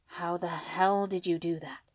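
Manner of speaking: slow, understated amazement; astonished. Acted. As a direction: ask it in mild disbelief. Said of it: "Izuku questioned, astonished".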